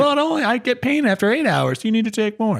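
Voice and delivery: high-pitched pleading patient; complaining patient. Acted. Voices a complaining pain patient.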